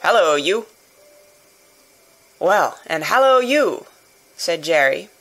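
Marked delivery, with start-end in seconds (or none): 0.0-0.7 guard's lightly gruff call; firm challenging hallo; gruff hail. A narrator's reading. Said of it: "in gruff soliloquy". 2.4-3.9 loud, mildly hoarse hail, climbing at the end; lightly hoarse answering shout. A narrator's reading. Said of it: "more hoarsely than before".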